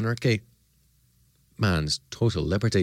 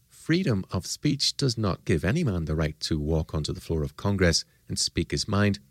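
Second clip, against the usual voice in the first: ordinary talk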